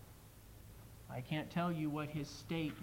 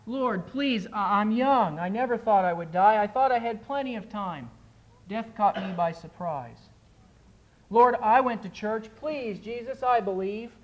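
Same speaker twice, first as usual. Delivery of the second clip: desperate pleading; 5 semitones higher, 9 dB louder than usual. Acted. Soul begging Jesus at judgment.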